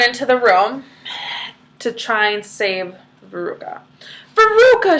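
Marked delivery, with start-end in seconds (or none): 4.3-5.0 high, loud call down the chute; shouting. Acted. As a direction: shout her daughter's name. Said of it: "shouted Missus Salt".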